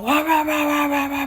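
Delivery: high, run-on "rah rah" jabber; mock ranting noise. Men getting all in your face, mocked ranting. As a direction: mimic someone yapping in your face.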